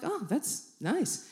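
soft, slow, underwhelmed reply; unimpressed politeness. Retold or imitated. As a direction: politely feign interest, slowly and quietly. Feigning polite interest in a story.

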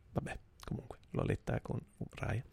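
whispered aside. Real, not acted. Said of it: "No, era Wakefield".

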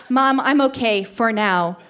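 plain reading voice

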